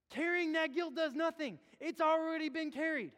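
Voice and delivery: loud, high pleading sermon; fervent pulpit call; full-force preaching. Acted. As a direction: preach it hard to the church.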